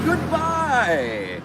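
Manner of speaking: high, drawn-out falling goodbye; high mock-regal farewell; singsong goodbye. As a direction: sing it to the passing cars. Waving greeting to passing traffic.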